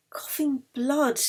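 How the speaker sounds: ordinary delivery, nothing marked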